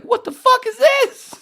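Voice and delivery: very high, loud squeal of disbelief; high-pitched stunned yelp at the radio; shocked. Retold or imitated. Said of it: "And I'm like".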